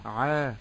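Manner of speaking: عا with a drawn-out aa; lengthened ayn. Shown as a demonstration. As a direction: hold the vowel of عا too long. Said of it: "زدت في زمن العين". («عا» بعين ممدودة)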